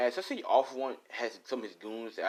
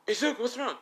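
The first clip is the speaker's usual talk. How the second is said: much higher, alarmed question; acted concern; 14 semitones higher than usual. Friend asking the crying boy, high-pitched concern. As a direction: pitch leaps up in mock worry.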